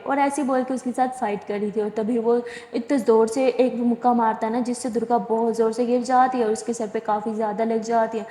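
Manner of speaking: usual speaking voice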